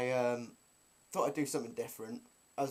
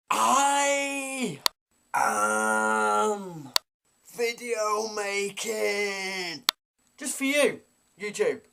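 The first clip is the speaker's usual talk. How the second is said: loud proclamation; 7 semitones higher, 5 dB louder, much slower than usual. Acted. Grand announcement to viewers.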